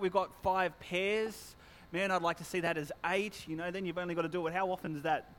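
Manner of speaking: normal talking voice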